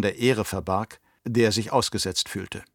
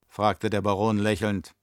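ordinary delivery, nothing marked

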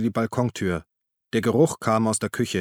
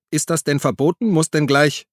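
louder and higher, indignant; raised voice; 6 semitones higher, 5 dB louder than usual. A narrator's reading. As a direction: push the voice up in protest. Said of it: "Uli hob die Stimme". (mit erhobener Stimme)

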